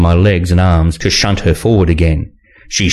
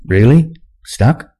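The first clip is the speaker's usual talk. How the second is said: slightly higher-pitched query; mild questioning; 5 semitones higher, slower than usual. A narrator's reading. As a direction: ask with a touch of disbelief. Man questions trapped woman.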